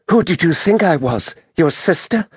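high-pitched woman's voice, questioning; Merinda. A narrator's reading. Injured woman answers his doubt, high questioning voice. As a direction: challenge him in a high, wounded woman's voice.

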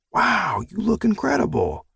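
high, playful gasp of wonder; exaggerated wonder. A narrator's reading. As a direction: gush over her like she's royalty. Father admires dressed-up daughter.

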